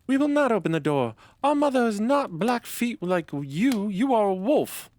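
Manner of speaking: put-on a little kid reassuring its mother, very high; little goat kid voice. A narrator's reading. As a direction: squeak it out like a small goat child. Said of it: "the children saw them and cried".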